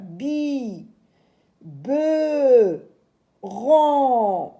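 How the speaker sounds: BI, BE, RON each held long, loud on BE and RON; syllable-by-syllable reading. Shown as a demonstration. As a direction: stretch out BI... BE... RON with gaps. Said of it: "je vais lire le mot".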